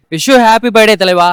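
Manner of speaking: loud slow birthday wish. Real, not acted. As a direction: call it out slowly and proudly. Birthday wish shouted to star.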